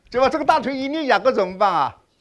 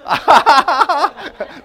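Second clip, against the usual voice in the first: loud, higher burst of laughter; hearty laugh; 8 semitones higher, 9 dB louder than usual. Real, not acted. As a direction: laugh out at the brother's answer. Laughing at the brother.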